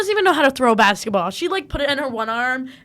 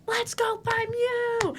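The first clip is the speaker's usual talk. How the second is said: high-pitched, held-back sideline cheer; excited high call without full volume; mock cheer; 12 semitones higher, 4 dB quieter, much slower than usual. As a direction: imitate a teammate cheering from the bench. Retold teammate cheering from bench.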